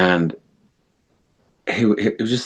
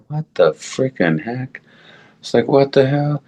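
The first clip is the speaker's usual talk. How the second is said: retold bewilderment; 7 semitones higher than usual. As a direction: react stunned, stretching it out in a raised pitch. Retold shock after being jolted awake.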